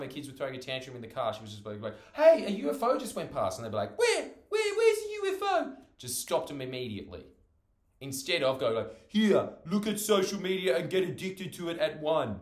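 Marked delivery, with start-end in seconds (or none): 2.1-3.6 put-on high, loud alert to the kids; mock-excited mum. Imitates mum distracting tantruming kids. 4.0-5.7 put-on very high, loud child voice; imitation of eager children; excited kids. 9.1-12.4 high, loud mock-parent offer; sarcastic parent. Sarcastic parent handing over social media.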